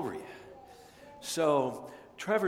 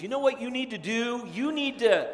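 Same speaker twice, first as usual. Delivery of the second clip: bossy quote; 7 semitones higher than usual. Retold or imitated. Family member bossing someone around.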